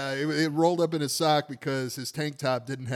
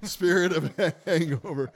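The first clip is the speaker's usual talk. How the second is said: ordinary delivery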